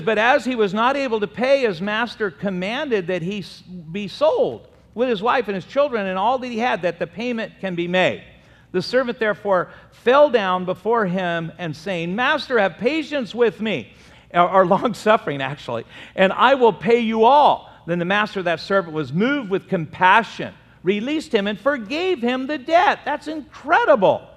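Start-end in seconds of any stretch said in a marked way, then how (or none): 0.0-13.9 fast, higher, louder reading; scripture reading. Acted. Preacher reading Matthew to the church, proclaiming. 16.2-23.0 fast, higher, louder reading; scripture reading. Acted. Preacher reading Matthew to the church, proclaiming. 23.0-24.3 high, loud exclamation; amazed. Real, not acted. Preacher marveling at the forgiven debt, excited.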